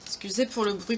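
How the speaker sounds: ordinary talk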